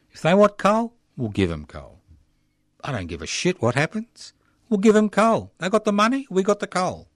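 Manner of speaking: high, loud sneering mock voice; mocking the minister.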